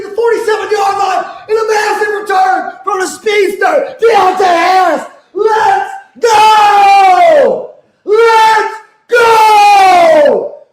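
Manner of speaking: high, loud, roaring game call; excited shouting play-by-play. Real, not acted. Streamer yelling play-by-play to his chat, high and loud. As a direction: call the big return like the stadium is shaking.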